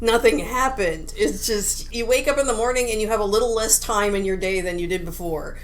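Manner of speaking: ordinary delivery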